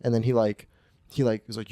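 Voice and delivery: usual speaking voice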